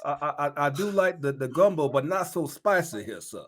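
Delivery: a light put-on Southern drawl, polite; genteel Southern drawl, lightly hammed; mock Southern drawl. Mocking the coach's sudden Southern accent. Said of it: "code switch".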